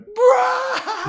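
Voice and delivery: very high mock burst of laughter; imitated group outburst. Host mimics the crew laughing at the old intro clip, high mock outburst. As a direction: blurt a high-pitched noise that breaks into laughing. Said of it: "so fucking triggering of us where he's like".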